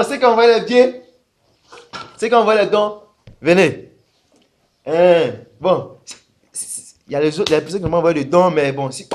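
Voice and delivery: mock pastor calling for donations; hammed-up preacher collecting money; a hint of a greedy pastor; lightly voiced pastor. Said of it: "le pasteur, il dit".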